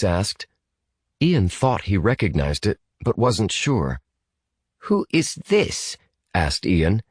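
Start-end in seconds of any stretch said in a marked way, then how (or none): 4.8-6.0 high, slow British demand; guarded British boy. Acted. British boy questions an unknown caller, slow and guarded. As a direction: draw it out in a British accent. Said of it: "asked Ian in his stiffest British accent".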